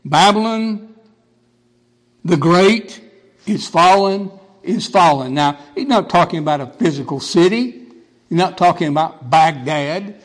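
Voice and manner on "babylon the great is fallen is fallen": strong, raised herald's proclamation; mighty cry. Acted. Preacher quoting the mighty angel's cry over Babylon, strong proclamation. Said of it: "He cried mightily with a strong voice".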